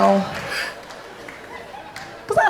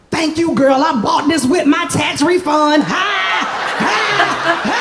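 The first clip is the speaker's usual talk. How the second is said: hammed-up sassy fan; 7 semitones higher, slower than usual. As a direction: overdo the sassy slang.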